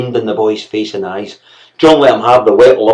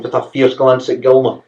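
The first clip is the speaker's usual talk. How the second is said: ordinary delivery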